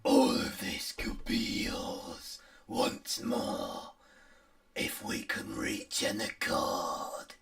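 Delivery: slow, drawn-out whisper; eerie whispered temptation; tempting hag's voice. Acted. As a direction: whisper a sinister bargain very slowly. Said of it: "you hear the voice go".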